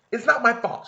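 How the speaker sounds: high, loud defensive. Acted. Lauren defending herself to silent Kaylee, loud. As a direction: insist it's not on you. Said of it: "She says nothing".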